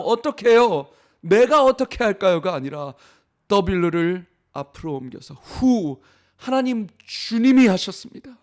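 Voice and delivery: impassioned preacher driving home the point; full-force preaching. Real, not acted. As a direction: build the sermon to its climax. Climactic preaching to the congregation.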